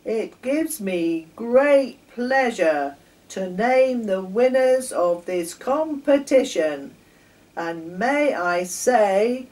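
loud, slow, grand chairman voice; pompous judge's announcement. Acted. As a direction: boom it out like a proud rabbit chairman at the mic. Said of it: "made the following announcement".

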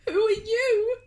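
put-on high, tearful girl's voice; a small girl sniffling; teary girl asking. A narrator's reading. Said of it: "a girl sniffed".

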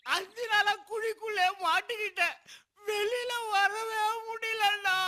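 slow, crying complaint; tearful wail. Acted. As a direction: wail helplessly to a would-be rescuer. Trapped man wailing his plight.

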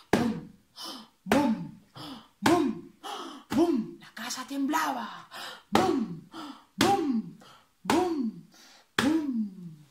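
stomp out each heavy step; booming footsteps. Acted. Bear footsteps sound effect.

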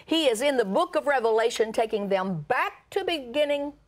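preacher building to a declaration; impassioned preaching. Acted. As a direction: proclaim it to the congregation. Preaches to congregation.

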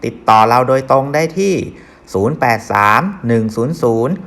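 loud, punchy radio ad read; ad announcer voice. Acted. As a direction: sell it like a loud commercial.

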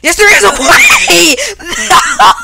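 very high, loud, drawn-out victory shriek with laughter; ecstatic screaming. Real, not acted. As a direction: scream in disbelief at a clutch win. Clutch win, screams.

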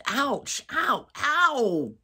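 sharp high ouch; pained yelps. Real, not acted. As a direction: yelp at a sudden bite. Pet biting her hand.

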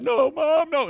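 high, loud mock wail of children; imitating crying kids. Kids beg mom not to be spanked, high mock wail. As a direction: cry out like kids begging mom. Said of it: "they start crying".

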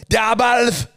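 high, loud imitated Scottish nurse; Scottish accent. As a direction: bark it in a thick Scottish accent. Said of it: "most thick Scottish fucking accent".

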